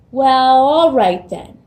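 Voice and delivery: loud, slow troll giving in; grudging troll. Acted. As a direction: let the troll grumble yes. Troll agrees.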